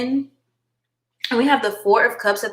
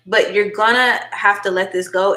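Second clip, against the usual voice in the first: normal talking voice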